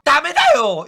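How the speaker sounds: high, urgent mock protest; 미코 objecting. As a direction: blurt out a high-pitched no. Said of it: "미코도 니코피 이야기가 나오자마자". (높게 다급히 반대하는 흉내)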